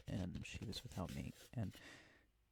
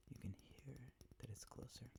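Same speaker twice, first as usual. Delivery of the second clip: whispering; 12 dB quieter than usual. Real, not acted. ASMR whisper video.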